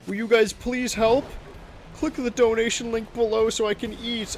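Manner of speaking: high, pitiful begging voice; whiny beggar voice. Pretend. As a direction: plead like a starving beggar. Mock begging poor streamer.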